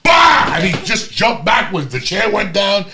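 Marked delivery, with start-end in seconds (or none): 0.0-0.5 loud, high mock yell of release; imitated climax yell. Old bar guy hamming his climax, sudden mock yell. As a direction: belt out a sudden hammed-up bah. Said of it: "he goes".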